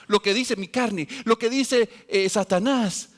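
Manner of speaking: loud, high-pitched preaching push; fiery preaching. Acted. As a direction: drive it home to the congregation. Preaching at full force. (predicación con fuerza, alta y enérgica)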